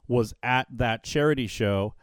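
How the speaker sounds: normal talking voice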